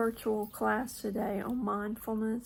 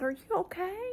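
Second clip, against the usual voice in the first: calling to puppy; 9 semitones higher than usual. Retold or imitated.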